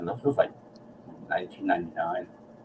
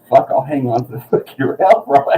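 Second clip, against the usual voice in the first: laughing bravado; 4 semitones higher, 5 dB louder than usual. Retold or imitated. Joking about riding the helicopter.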